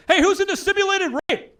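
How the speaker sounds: high, bright, loud showman greeting; mock-cheerful comic opening the show; chirpy stage opener. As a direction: sell it like a cheery host.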